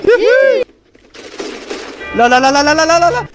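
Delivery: high whooping and yelling "no"; excited yelling. Real, not acted. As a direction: whoop and shout at the screen. Wild reaction during play.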